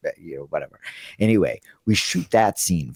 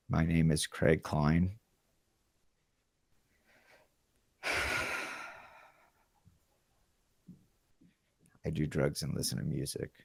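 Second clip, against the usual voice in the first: flat, much softer, dead-serious self-intro; deadpan intro; 3 semitones lower, 12 dB quieter than usual. Retold or imitated. To the casting camera, a quiet deadpan. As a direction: deliver it bone dry, like it's no joke. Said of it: "I looked into the camera like this".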